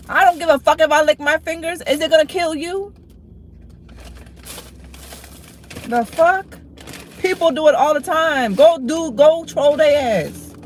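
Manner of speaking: high-pitched, real exasperated yelling; angry rant at chat. Real, not acted. Fed up with chat, she rants at them in a high angry voice. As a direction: snap back hard at the trolls. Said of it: "telling me to relax".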